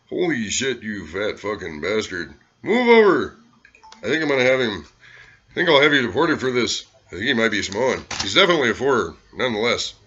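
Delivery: put-on loud, high, manic drunken rant; Hunter Thompson impression. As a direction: bark insults like a drunk gonzo writer at the bar. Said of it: "Hunter Thompson".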